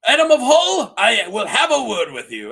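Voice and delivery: loud, booming mock summons; Sea Smoke. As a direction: call him out like a lordly dragon. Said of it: "He's like".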